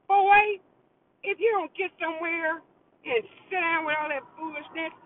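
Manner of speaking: put-on sharp, high scold of the boy; mock scolding. Telling off the late texter, sharp mock scold.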